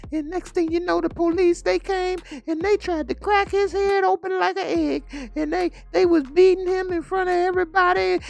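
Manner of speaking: a scared young girl blurting bad news; frightened girl. Daughter runs in and tells Bumpy in a high panicked voice. Daughter retells the police beating.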